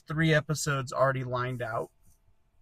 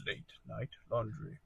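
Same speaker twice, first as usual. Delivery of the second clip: soft, low title drop; hushed and understated; hushed title read; 5 semitones lower, 12 dB quieter than usual. Pretend. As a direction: say LAUNDRY quietly, like a late-night radio host. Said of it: "That was good".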